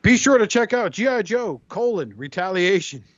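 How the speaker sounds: radio ad voice. Retold or imitated. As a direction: sell it like a radio promo. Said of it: "play their sponsors".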